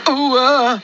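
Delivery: high, loud frightened wail. Acted. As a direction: wail as the buffers break. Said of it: "wailed Percy".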